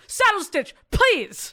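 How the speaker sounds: high barked order; stiff barking toy soldier. Pretend. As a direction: snap it like a sergeant. Sergeant roleplay.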